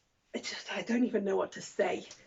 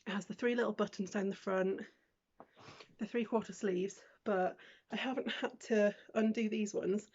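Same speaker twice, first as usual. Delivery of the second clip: ordinary talk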